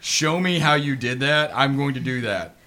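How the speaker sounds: eager excitement. Retold or imitated. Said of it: "How did you do that?".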